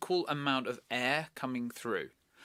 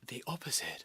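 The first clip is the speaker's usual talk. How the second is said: whisper; 6 dB quieter than usual. Real, not acted. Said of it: "sounds very technical".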